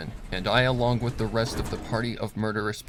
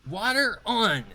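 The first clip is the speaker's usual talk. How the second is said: much higher, loud called order; commanding shout; 13 semitones higher, 4 dB louder, slower than usual. Acted. Boss shouting to a worker across the room, commanding. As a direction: call it across the factory floor. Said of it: "commanded Rainbow Dash".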